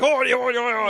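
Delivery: loud gibberish French chewing-out; mock French rant. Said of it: "just chewed him out down the bottom".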